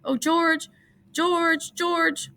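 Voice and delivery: high, desperate calling; Lenny crying for George, voice very high. A narrator's reading. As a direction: call out for help.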